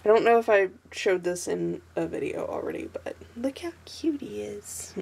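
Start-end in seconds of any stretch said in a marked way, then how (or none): 2.9-4.9 soft breathy aside over how cute he is; gushing whisper. Real, not acted. Showing her sketchbook, she whispers about the cute drawing. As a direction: whisper it like you can't contain the cuteness.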